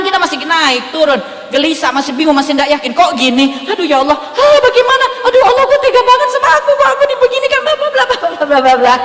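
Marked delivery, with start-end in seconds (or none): 3.7-8.9 high, fast, laughing whine of a desperate believer; mock panicked lament. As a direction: parody the panicked complainer with a laugh in the voice. Mocking a panicked complaint to God. (meratap panik dengan suara tinggi, cepat, sambil tertawa)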